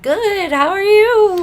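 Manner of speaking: very high, loud, overdone friendliness; fake cheery greeting. Caught-out client greeting the officer on the phone, fake cheery squeal. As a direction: squeal it out as if nothing is wrong.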